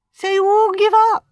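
high, loud musing; thoughtful. A narrator's reading. Jane to the defeated guests, thoughtful. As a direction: ponder it aloud in a lifted voice. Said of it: "said Jane thoughtfully".

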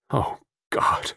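stunned dismay. A narrator's reading. Barton over Pauline's body, aghast. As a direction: breath-only groan of dismay.

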